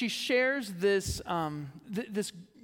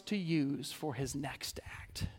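whispered close; 5 semitones lower, 7 dB quieter than usual. Acted. Ending commentary quote.

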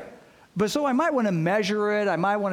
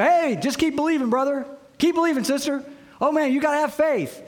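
higher, louder, breezy brush-off; mock glib cheer; 8 semitones higher, 5 dB louder than usual. Friends brushing off a doubter's questions, mock glib cheer. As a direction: ham up the empty pep talk.

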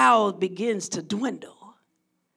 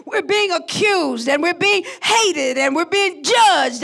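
slow, louder, higher declaration; impassioned preaching; 5 semitones higher, 5 dB louder, slower than usual. Acted. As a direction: preach the grievance with force. Preaching at full force.

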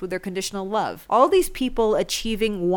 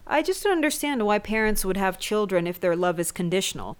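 ordinary talk, nothing marked

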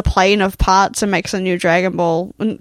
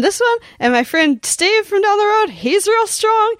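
put-on high girly voice; 12 semitones higher than usual. Voicing the girl, high voice.

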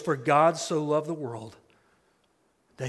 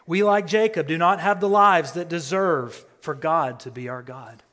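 raised, building preacher voice; preaching louder; 3 semitones higher, 9 dB louder than usual. Real, not acted. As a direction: drive the phrase home, louder. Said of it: "repeated line, louder second time".

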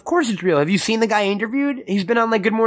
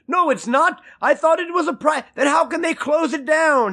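mock indignant cameraman; 10 semitones higher than usual. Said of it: "he's like".

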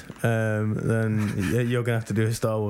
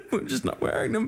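laughing. Real, not acted. Laughing about wearing specs.

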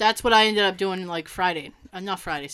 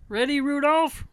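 playful Christmas cartoon voice; a bright cartoon Rudolph, mid-scene; 3 semitones higher than usual. An excited cartoon Santa asking his reindeer if he's ready. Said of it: "uh, cartoon".

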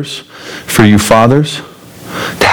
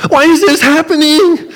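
much higher, slowed whining grown-up tantrum; tantrum whine. Acted. Preacher acting out a grown-up tantrum, high whiny wail. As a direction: wail it like a grown man throwing a fit. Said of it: "grown-up temper tantrums".

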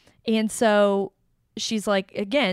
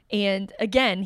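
normal talking voice

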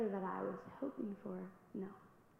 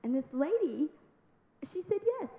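ordinary talk

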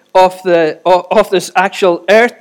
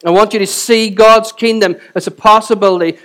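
ordinary talk, nothing marked

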